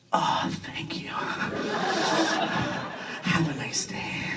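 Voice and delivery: hushed, breathy near-whisper; stunned soft murmur; awed cashier. Acted. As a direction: barely get it out, very soft. Cashier handed card from bra.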